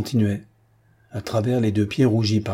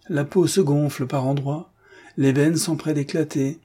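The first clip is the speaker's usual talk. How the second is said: ordinary delivery, nothing marked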